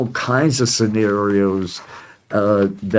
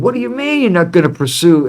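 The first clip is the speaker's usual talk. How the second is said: higher, fast, indignant challenge; pissed-off questioning; 7 semitones higher, 3 dB louder, faster than usual. Retold or imitated. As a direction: imitate an incensed man demanding an answer. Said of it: "he was as pissed off as I am right now".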